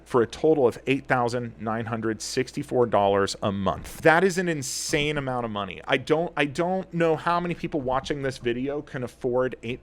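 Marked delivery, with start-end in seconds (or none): none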